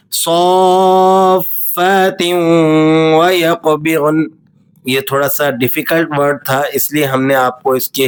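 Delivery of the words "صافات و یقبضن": صافات و یقبضن slow, with a stop on ت; stopping sound. Shown as a demonstration. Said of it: "stopping sound میں اسے پڑھیں گے". (صافات پر وقف کے ساتھ)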